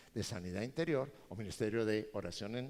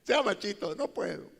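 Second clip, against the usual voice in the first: mock crying; 7 semitones higher, 8 dB louder than usual. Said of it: "Y uno llorando".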